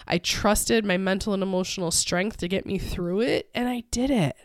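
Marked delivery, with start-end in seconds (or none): none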